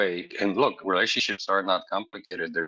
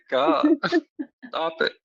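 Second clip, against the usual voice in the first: laughing; 4 dB louder, slower than usual. Real, not acted. Laughing at partner's compliment.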